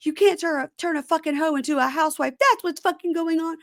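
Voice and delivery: high-pitched mock indignation; hammed-up tearful tirade before storming off; Amani's dramatic outburst. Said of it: "this is when she goes".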